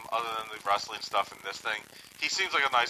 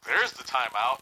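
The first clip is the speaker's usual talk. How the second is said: ordinary talk, nothing marked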